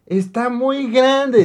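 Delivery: put-on high, slow, awed girlfriend voice; mock her awe, high and drawn out; imitating girlfriend. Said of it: "Y me dice".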